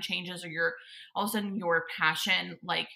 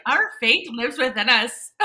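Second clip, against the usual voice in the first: loud, slow attempt at a Scottish accent; high-swooping mock Scottish declaration; Merida; 4 semitones higher, 9 dB louder, slower than usual. Guest imitates a film heroine for her friend, shaky-attempt Scottish accent. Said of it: "I can imitate Merida".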